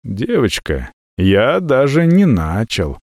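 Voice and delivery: ordinary delivery, nothing marked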